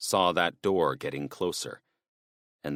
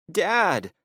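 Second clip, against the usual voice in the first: much higher and louder, crying out; a scared boy yelling for his father; shouting Dad; 12 semitones higher, 4 dB louder than usual. Acted. Tommy shouting to his father during the fight. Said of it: "Tommy shouted".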